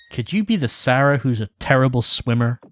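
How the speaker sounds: usual speaking voice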